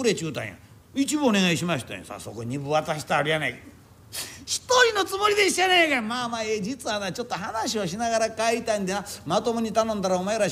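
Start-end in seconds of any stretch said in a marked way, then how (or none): 4.4-6.0 a bearer whining he was tricked into two fares; wailing protest. Acted. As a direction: cry out that you were cheated.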